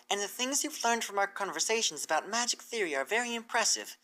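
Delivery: an excited teacher mare pitching an offer; Twilight's voice. Acted.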